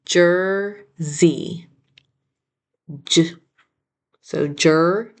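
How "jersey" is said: Jersey split slowly, JER, then ZEE; syllable by syllable. Shown as a demonstration. Teacher introducing a spelling word, "Jersey" syllable by syllable. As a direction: leave a gap between the two beats of "Jersey".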